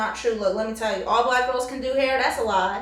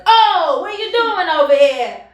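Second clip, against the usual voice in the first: loud, raised-pitch mobster-style stepdad; hammed-up Italian American bluster; 5 semitones higher, 6 dB louder than usual. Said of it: "talks like the stereotypical Italian man".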